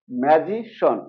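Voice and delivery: "ম্যাজিশন" ending in one syllable shon; correct SHON ending. Shown as a demonstration. Teacher gives the correction, "ম্যাজিশন" ending in shon. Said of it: "recap".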